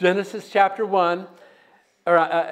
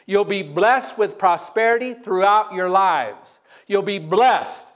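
slow blessing; 3 dB louder, slower than usual. Real, not acted. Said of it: "slow emphatic blessing".

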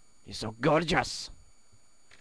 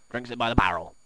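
usual speaking voice

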